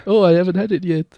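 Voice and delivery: lightly put-on Mexican accent; mock Mexican accent. Playing a kid in a mock Mexican accent. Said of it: "What is this voice?".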